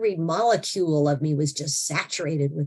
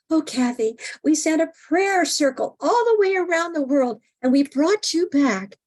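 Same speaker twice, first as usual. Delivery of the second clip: much higher, louder proud mother; mom's voice; 10 semitones higher, 3 dB louder than usual. Retold or imitated. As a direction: gush it like a proud mom. Mom explains the prayer circle.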